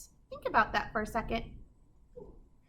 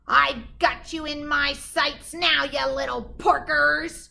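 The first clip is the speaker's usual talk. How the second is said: slow, louder menacing witch; cackling witch; 5 semitones higher, 7 dB louder, slower than usual. A narrator's reading. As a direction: gloat down at the pigs from above. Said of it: "she cackled as she circled".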